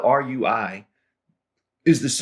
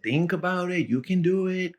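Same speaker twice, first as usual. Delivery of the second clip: gentle, higher coaxing encouragement; coaxing teacher; 9 semitones higher, 3 dB quieter than usual. Retold or imitated. Javier coaxing his stuck student, softly. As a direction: coax him along softly.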